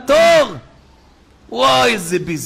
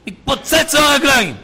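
higher, punched-up exclamation; dramatic outburst; 6 semitones higher than usual. Real, not acted. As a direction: exclaim it in raised pitch. Dramatizing the unbearable leg pain.

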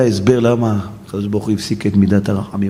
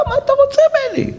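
much higher, fast, whining what-do-you-want; exasperated protest; faster than usual. Acted. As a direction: toss it off, fed up and squeaky. Rabbi protests to the prophet.

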